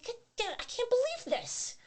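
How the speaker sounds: high, shocked and incredulous; retold shock at the husband walking out; shocked disbelief. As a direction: pitch way up in stunned disbelief. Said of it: "I'm like".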